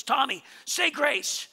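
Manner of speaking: mock exasperation, each word drawn out; upset mom. Mom orders next son to pray, imitated upset. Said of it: "she gets really upset".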